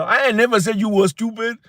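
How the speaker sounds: lightly put-on American accent, pitched high. Host repeating his denial to Americans, mock American accent. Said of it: "an American accent".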